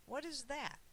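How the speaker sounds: put-on curious child. A narrator's reading. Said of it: "when Sam asked his mom".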